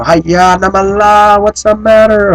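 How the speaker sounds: high, loud mock exasperation; frustrated exclamation. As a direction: throw up your hands, hi-ya namala. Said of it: "when they get frustrated, they go".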